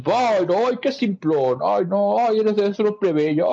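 put-on high, snooty taunting kid voice; high prissy child mocking a classmate; mocking bully kid. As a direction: sneer it out like a spoiled brat. Bully kids mocking Anya.

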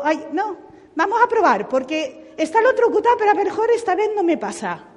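más aguda, insensata y confiada; voz de imprudente. Retold or imitated. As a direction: imita a alguien que se arriesga sin pensar. Imagined reckless person's thoughts. (tono de quien no aprende, agudo y rápido)

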